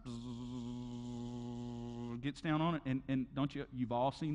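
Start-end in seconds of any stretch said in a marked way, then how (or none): 0.1-2.2 one long low, soft drone; imitated machine buzz. Preacher miming the claw coming down, imitated buzz. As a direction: hum like a descending motor. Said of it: "And here it comes".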